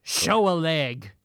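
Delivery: slow, loud pirate call. Shown as a demonstration. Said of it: "I'm gonna say it like a pirate".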